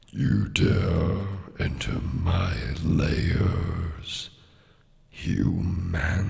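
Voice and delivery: slow, drawn-out dragon threat. Pretend. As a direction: play a menacing dragon, very slow. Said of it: "prepare with the dragon".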